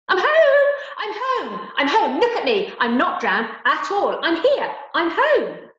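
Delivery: high, loud, desperate shouts; shouting. Acted. Girl bursts in on her grieving family, shouting. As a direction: cry out to be noticed. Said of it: "burst into the room shouting".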